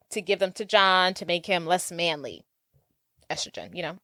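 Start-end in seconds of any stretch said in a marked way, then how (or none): 3.3-4.0 conspiratorial whisper; whispered aside. Real, not acted. Host whispers the plot twist to cohost. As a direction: lean in and whisper it like a secret.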